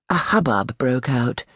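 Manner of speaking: plain reading voice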